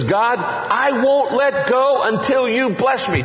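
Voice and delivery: high, slow, pleading insistence; passionate plea. Pretend. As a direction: cry out to God, refusing to let go. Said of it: "Do you hear the passion in that?".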